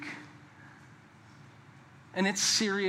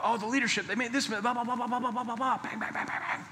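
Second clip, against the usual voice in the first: a higher, whiny parody of a grumbler; mock complaining; 5 semitones higher, 4 dB quieter than usual. Pastor mocking a church member griping about leadership, whiny parody. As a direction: mimic the griper rattling off complaints.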